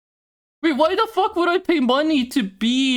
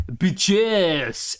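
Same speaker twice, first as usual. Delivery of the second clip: a fuller French-accented "Bitches", imitated with more flair; 2 semitones lower than usual. Retelling how the French lady said it, imitated French accent. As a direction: give "Bitches" the French lady's attitude and lilt. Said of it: "the energy and intonation".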